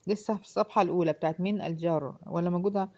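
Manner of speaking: ordinary talk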